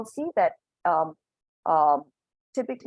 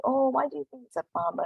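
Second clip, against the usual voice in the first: teacher asking; 8 semitones higher than usual. Shown as a demonstration. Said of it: "the teacher could be asking".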